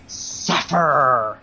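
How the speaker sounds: eerie voice from the darkness; a menacing voice out of the dark; dark whisper behind you. Acted. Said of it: "you hear a voice from the darkness that says".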